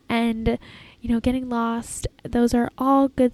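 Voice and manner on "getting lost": usual speaking voice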